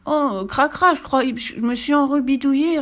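put-on small high child voice; little boy's small confused voice; tiny voice. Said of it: "d'une toute petite voix".

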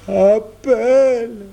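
put-on high little-boy plea, very slow; childlike urging. A narrator's reading. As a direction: urge him in a high, stretched child's voice. Boy urging brother to call.

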